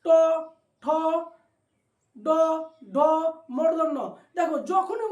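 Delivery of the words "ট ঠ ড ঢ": ট ঠ ড ঢ said one by one with tongue curled back; sounding out retroflex letters. Shown as a demonstration. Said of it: "তোমরা করো".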